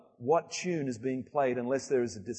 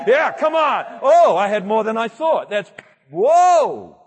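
excited; 6 semitones higher, 12 dB louder than usual. Real, not acted. Crowd of hands goes up.

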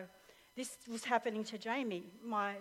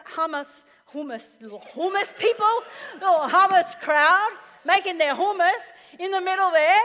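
ordinary talk

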